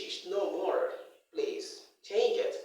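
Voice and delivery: ordinary talk, nothing marked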